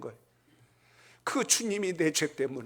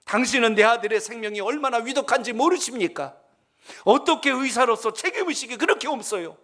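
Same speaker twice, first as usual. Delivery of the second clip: raised, louder, furious father scolding the doctor; a frantic father yelling at the surgeon; angry shout; 7 semitones higher, 3 dB louder than usual. Retold or imitated. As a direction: bark the blame at the late doctor. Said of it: "소리를 지르는 거예요".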